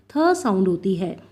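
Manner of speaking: ordinary talk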